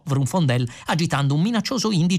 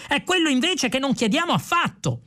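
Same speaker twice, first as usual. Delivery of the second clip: high-pitched exasperated correction; cross, high exclamation; irritated; 12 semitones higher than usual. A narrator's reading. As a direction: contradict your partner crossly in a raised pitch. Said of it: "esclamò irritato Magic Tice".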